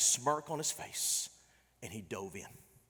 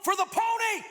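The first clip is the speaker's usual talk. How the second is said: high, loud yelled command; coach's scream; 5 dB louder than usual. Retold or imitated. Coach screaming at his players, loud and high. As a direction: bellow it across the field. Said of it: "he would scream".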